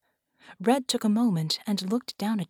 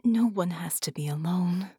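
whisper. Acted. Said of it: "he heard a voice".

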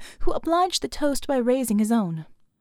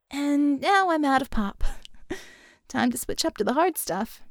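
put-on higher, playful girl with a laugh; Vicky's voice; 5 semitones higher than usual. A narrator's reading. Vicky joking over the mic, higher and laughing. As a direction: chuckle as she reaches for booze.

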